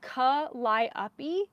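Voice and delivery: Calliope in separate syllables, kuh-LIE-uh-pee, rising doubtfully; sounding out. Real, not acted. Knitter testing a name she doubts, Calliope split into kuh-lie-uh-pee. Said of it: "That does not sound right".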